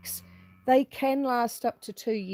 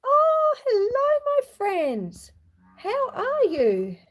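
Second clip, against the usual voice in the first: excited greeting; 9 semitones higher, 4 dB louder than usual. Real, not acted. Greeting arriving viewers on live.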